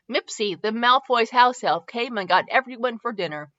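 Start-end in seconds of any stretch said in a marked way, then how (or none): none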